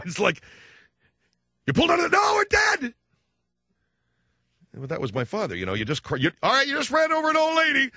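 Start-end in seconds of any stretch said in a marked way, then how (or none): 1.7-2.9 high, loud alarm; mock panicked shout. Father shouting at son in the car, loud and high. 6.4-7.9 high, strident mock father scolding; imitating dad. Dad scolding his son after a parking mistake, high and shrill.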